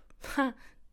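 short breathy nervous laugh; a quick anxious giggle. Real, not acted. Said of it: "such a nervous laugh".

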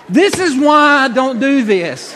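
high, loud, slow wail; exasperated outburst. Retold or imitated. Husband as masseuse pulls his shorts down, loud exasperated wail. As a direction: cry it out, stretching each word. Outraged as towel is ripped down.

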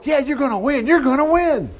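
high-pitched, hollow cheering; mock false prophets. Lying prophets telling the king he'll win, mock cheering. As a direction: egg the king on with fake hype. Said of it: "They're going".

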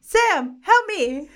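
high, loud playful plea; calling for help. Pretend. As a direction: cry out for help, hamming it up. Calling out to the sound engineer.

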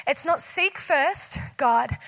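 ordinary talk, nothing marked

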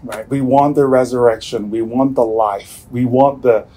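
usual speaking voice

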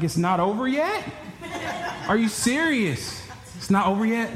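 high, laughing disbelief. Retold or imitated. Dad in the car, stunned the prayers continue, laughing in high disbelief. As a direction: laugh in disbelief that it isn't over. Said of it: "I'm like".

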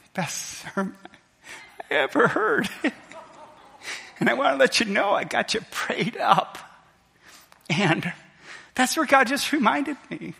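high, broken words through sobs; real crying, voice climbing and catching; tearful, overcome. Real, not acted. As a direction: push the words out through tears. Overcome retelling son echoing late father.

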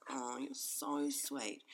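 low, slow, softened coo; touched, tender. Retold or imitated. Mum answers her son's kind words, touched. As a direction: drop low and draw it out tenderly.

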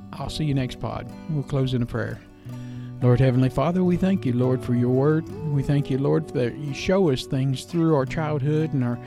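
usual speaking voice

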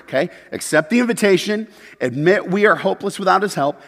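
usual speaking voice

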